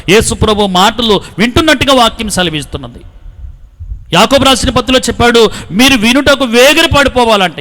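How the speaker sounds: fervent sermon delivery, pushing each line out; preaching. Acted. Preacher blessing the village and its farms, fervent preaching. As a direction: preach it to the crowd with rising fervour.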